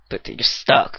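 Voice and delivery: ordinary talk, nothing marked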